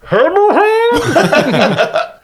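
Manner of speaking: high, drawn-out yell behind a door; muffled shout. Acted. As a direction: call out from inside a closet.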